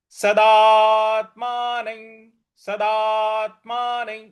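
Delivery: सदात्मानं with the दा drawn out long, slow; long vowel demo. Shown as a demonstration. Teacher demonstrates the long vowel, drawn-out slow recitation. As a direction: stretch the सदा in सदात्मानं. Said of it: "दीर्घ खींचना है".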